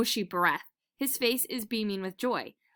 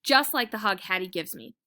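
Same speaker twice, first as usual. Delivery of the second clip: plain reading voice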